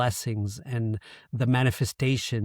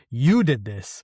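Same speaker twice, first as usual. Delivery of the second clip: accusing God, a touch louder; angry at God; 4 dB louder, slower than usual. Retold or imitated. Blaming God for the accident, mildly angry. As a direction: throw the blame at God, mildly heated. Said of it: "angry at God".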